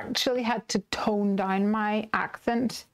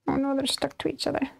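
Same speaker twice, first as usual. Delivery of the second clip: ordinary delivery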